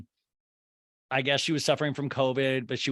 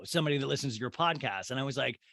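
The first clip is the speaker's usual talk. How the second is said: normal talking voice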